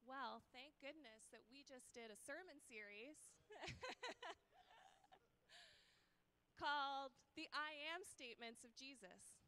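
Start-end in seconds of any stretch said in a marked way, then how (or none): 3.2-5.2 laugh. Real, not acted. Laugh during sermon.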